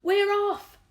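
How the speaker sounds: high, loud cry of joy; excited shout. A narrator's reading. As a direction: yell it in triumph. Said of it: "someone was shouting".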